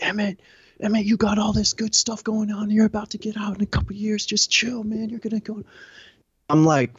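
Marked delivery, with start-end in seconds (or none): 0.0-5.6 high, strained voice imitating pals talking him down; urgent pleading friends. Buddies holding him against the wall, urgent retold pleading.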